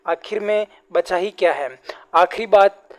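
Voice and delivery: usual speaking voice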